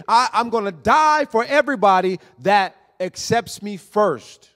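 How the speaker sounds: loud, high preaching that builds; preaching at full force. Acted. Preacher warns the congregation of the cliff, loud and urgent. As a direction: thunder the warning at the congregation.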